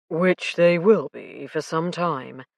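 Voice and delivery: a grim understatement, slowly. A narrator's reading. Alec standing over the handcuffed colonel, slow and grim. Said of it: "Alec said grimly".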